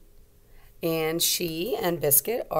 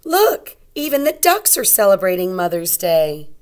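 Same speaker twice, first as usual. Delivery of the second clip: louder, bright storybook voice; reading to children; 8 dB louder than usual. A narrator's reading. Teacher reading a picture book aloud to young kids.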